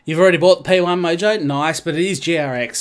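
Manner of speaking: usual speaking voice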